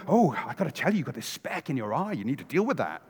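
lightly voiced hypocrite pointing out a speck; smug hypocrite. Hypocrite lecturing about a speck.

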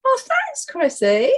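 sudden high squeal of thanks; delighted surprise. Real, not acted. Woman thanked for looking lovely, squealing high with delight. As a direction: caught off guard by a compliment. Greeted with a compliment.

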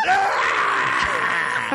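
loud, drawn-out yell; mock scream over smashed glass; hammed-up holler; imitated scream. Imitated yell after window smashes.

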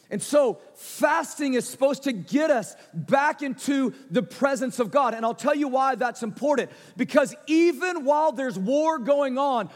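loud, rising preacher's cadence; preaching at full force. Acted. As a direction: preach it out, building conviction. Pastor builds up his preaching.

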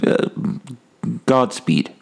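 ordinary talk

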